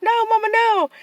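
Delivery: high, loud retold squeal; mock child wail. Daughter begging mom during a spanking, mock wail. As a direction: play up a kid's pleading yelp.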